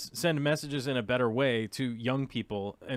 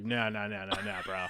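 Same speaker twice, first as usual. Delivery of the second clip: gruff boomer refusal; 3 semitones lower, 4 dB quieter than usual. Retold or imitated. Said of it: "they were just like".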